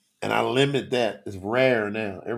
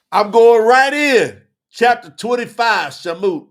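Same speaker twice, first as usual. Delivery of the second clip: loud, high, drawn-out proclamation; preacher launching into the chapter; hyped announcement; 9 semitones higher, 13 dB louder, slower than usual. Real, not acted. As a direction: announce it big and slow, voice raised.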